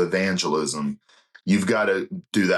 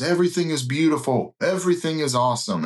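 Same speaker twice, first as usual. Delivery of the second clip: bright, higher sing-song fake positivity; mock cheery evangelist; 7 semitones higher than usual. Mocking a preachy evangelist on stage, in a chirpy sing-song voice. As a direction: gush like it's all sunshine. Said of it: "the missionary or evangelist who gets up and goes".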